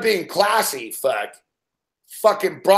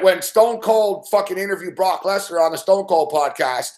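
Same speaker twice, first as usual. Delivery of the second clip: ordinary delivery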